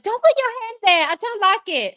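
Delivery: high, loud petulant whine; whiny girlfriend. Retold or imitated. Girlfriend pushing him off in bed, high loud whine. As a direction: whine it like a spoiled brat. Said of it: "she's like".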